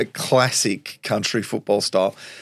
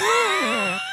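a burst of high-pitched laughter. Real, not acted. Storyteller laughing at his own coach yelling, high-pitched. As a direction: laugh out at the memory.

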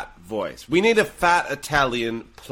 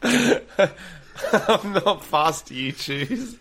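laughing through line; 6 semitones higher, much slower than usual. Acted. Improv couple scene, laughing.

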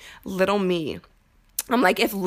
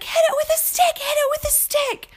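much higher, urgent shouted warning from grandpa; grandpa yelling. Retold or imitated. Grandpa calls out to warn her of the charging cow, high and urgent. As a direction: holler it like a panicked old man across the yard. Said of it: "And he's like".

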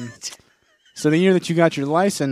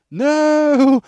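high, loud pained no; mock tripping guy; groaning guy; 12 semitones higher, 7 dB louder than usual. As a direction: moan it out in pain. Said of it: "And he goes".

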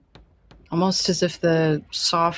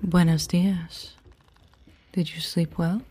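mysterious voice comforting a troubled captain; gentle ship spirit; 5 dB quieter than usual. Acted. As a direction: coax the captain softly. NPC gently speaks to Jesse.

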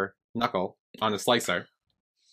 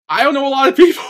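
high, loud mock protest; exasperated shout; 14 semitones higher, 8 dB louder, faster than usual. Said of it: "I'm like".

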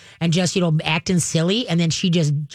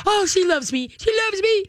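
very high, gushing dog voice; giddy high-pitched pet voice; delighted dog; 14 semitones higher than usual. Acted. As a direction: squeal like an adored puppy. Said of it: "the dog is gonna be like".

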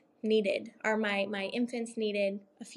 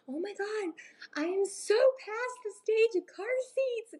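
much higher, exclaiming grandma voice; imitating her mom; 11 semitones higher than usual. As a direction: squeal it like a relieved grandma. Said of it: "she'll be like".

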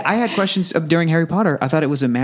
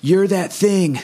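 higher, hammed-up epic hero lines; movie trailer dialogue; 8 semitones higher than usual. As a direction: overdramatic blockbuster speech. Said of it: "That great YouTube video that breaks it down where it's like".

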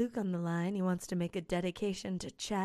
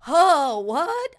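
mock surprise; 7 semitones higher, 12 dB louder than usual. Voicing Chastity startled.

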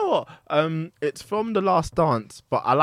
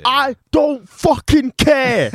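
slow, loud, high mock rant; hammed-up angry meme line; mock angry quote; 8 semitones higher, 6 dB louder, much slower than usual. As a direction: drag out the swear in mock fury. Quoting Top Boy meme.

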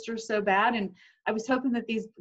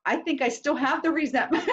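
laughing; 5 semitones higher than usual. Real, not acted. Laughs admitting lingering resentment.

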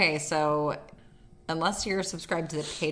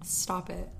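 normal talking voice

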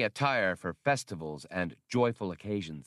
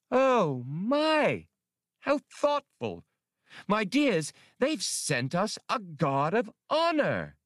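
much higher, far-off affected gush, delighted; 10 semitones higher, slower than usual. A narrator's reading. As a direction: play the fawning celebrity, drawn out. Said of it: "Atwari gushed".

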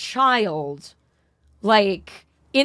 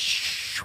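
shushing; 9 dB quieter than usual. Retold or imitated. Shushing the woman in retelling.

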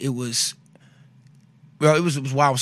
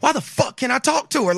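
pitched-up mock outburst at the doctors; retold yelling; 10 semitones higher than usual. Cussing at the doctors on the phone, high mock outburst. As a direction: replay the frustration, high and heated. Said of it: "I was already cussing at doctors".

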